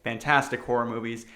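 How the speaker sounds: ordinary delivery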